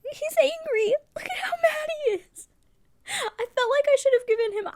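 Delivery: cry-laughing at a silly drawing; tearful laughing outburst; laughing to tears. Real, not acted. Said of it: "my eyes are actually tearing up".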